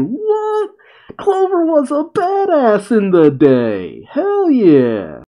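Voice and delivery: high, slow, hyped-up disbelief; stunned delight that builds into cheering; amazed. Real, not acted. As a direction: gush with amazement over a legend. Amazed at Clover's prison breaks.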